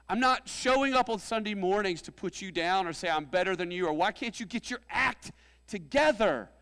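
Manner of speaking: high, loud preaching; impassioned rallying. Acted. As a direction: keep hollering to the team. Rallying on after the team shout.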